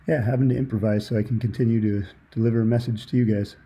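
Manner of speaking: ordinary talk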